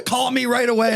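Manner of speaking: high, urgent imitation of a panicked mother; high urgent mom voice. Son quoting his mother's urgent phone call, high and pressing.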